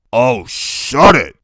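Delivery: loud, slow, exasperated; snapping shut it. Acted. Iggy telling Loretta to shut it, loud and exasperated. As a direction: cut her off sharply.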